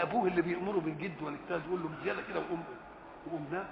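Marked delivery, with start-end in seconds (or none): 1.9-3.7 much softer coaxing to go to sleep; gentle father. Retold or imitated. Father softly coaxes his tired son to stop and sleep. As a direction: ease him off to bed, softly.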